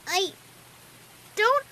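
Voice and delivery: put-on small high child voice, halting; questioning little filly. A narrator's reading. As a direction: ask haltingly, rising in doubt. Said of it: "she questioned".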